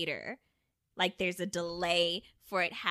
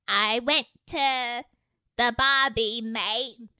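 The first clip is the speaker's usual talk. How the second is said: a slow, hammed-up New Zealand accent, higher and louder; bad New Zealand accent; 4 semitones higher, 5 dB louder, slower than usual. Asked for New Zealand, she hams up a Kiwi accent. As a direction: drawl out the mate like a mock Kiwi. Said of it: "Do a New Zealand accent".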